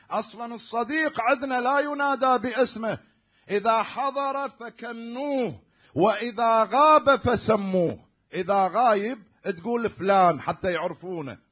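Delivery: loud, slow preacher's emphasis; rising sermon fervour; preaching at full force. Real, not acted. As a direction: press the maxim home to the crowd.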